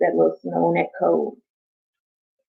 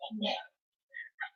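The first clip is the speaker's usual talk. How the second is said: breathy whispered ASMR-creator parody, very soft; mock the soft-spoken ASMR voice; 5 semitones higher, 7 dB quieter than usual. Mocking ASMR creators to her viewers, whispering. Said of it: "they be like".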